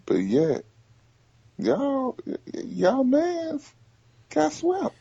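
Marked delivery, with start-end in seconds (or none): 1.6-4.9 high, drawn-out needling of a friend; high-pitched taunt. Real, not acted. Ribbing a friend about his team's sweep, high-pitched taunt. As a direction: rub it in, pitched way up.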